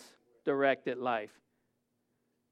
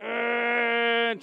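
frustrated grunt; 5 semitones higher than usual. Retold or imitated. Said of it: "And I'm like".

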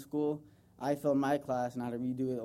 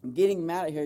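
ordinary talk

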